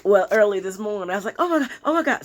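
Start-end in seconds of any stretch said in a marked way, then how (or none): 1.4-2.2 high-pitched retold fangirl gush; fangirl panic. Retelling her reaction early this morning, fangirl panic. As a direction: imitate a starstruck panic, pitched up. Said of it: "That's what I was doing last night".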